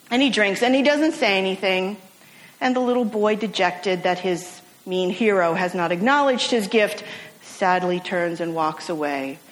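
usual speaking voice

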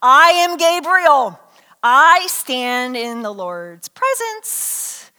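loud, very slow mock grandeur; grand, drawn-out angelic announcement; sarcastic angel. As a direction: stretch every word with pompous sarcasm. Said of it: "says sarcastically".

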